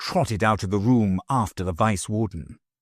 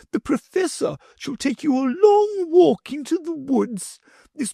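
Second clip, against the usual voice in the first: higher, sweetly wheedling; sugary lady. A narrator's reading. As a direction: lure the children away sweetly.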